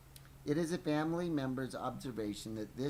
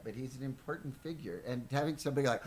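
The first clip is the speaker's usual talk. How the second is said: ordinary delivery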